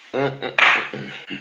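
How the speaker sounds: quiet, voiceless chuckle; amused laugh. Real, not acted. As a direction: chuckle under the breath. Laughs at the opponent.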